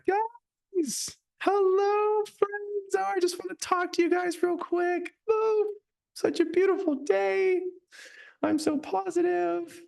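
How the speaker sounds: very high, hammed-up influencer voice; mock social media voice. Coach mocking how people talk once the camera goes on, in a very high influencer voice. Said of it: "Everybody has their social media voice too".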